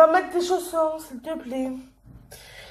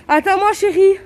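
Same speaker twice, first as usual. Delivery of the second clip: calling out; 7 semitones higher, 8 dB louder than usual. Real, not acted. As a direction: call her to wait. Calling to her daughter. (appel aigu et fort)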